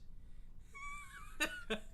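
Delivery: breathless wheezing laugh; helpless high-pitched laughter; high squealing laugh. Real, not acted. Laughing at the impression.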